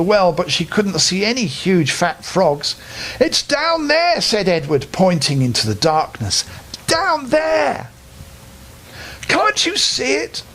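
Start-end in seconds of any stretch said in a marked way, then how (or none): 3.2-4.2 put-on boy voice. A narrator's reading. Edward luring the witch. 6.9-10.4 put-on boy voice. A narrator's reading. Edward luring the witch.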